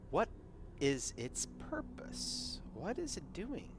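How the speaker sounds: normal talking voice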